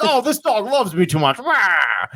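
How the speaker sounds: high-pitched comic whine ending in a growl; playfully overwhelmed, pitched well up; mock exasperation. Owner gripes about his over-loving dog, mock exasperated.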